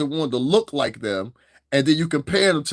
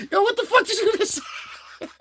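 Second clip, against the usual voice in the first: high-pitched, breaking up with laughter; laughing disbelief. Retold or imitated. Reacting to his date's insult, laughing in disbelief. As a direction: squeal the retold reaction through laughter. Cracking up retelling his shocked reply.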